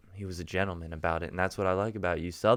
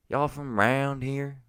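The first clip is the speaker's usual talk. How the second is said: higher, slow Southern drawl; Southern accent; 6 semitones higher, slower than usual. Shown as a demonstration. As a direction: ask it in a stretched-out Southern accent. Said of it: "Because you're Southern and that's what you do".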